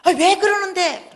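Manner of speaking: high, loud, drawn-out complaint; angry protest. Retold or imitated. Friend begs him not to tell husband, angry protest. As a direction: wail it out as the exasperated friend. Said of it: "화를 내는 거예요".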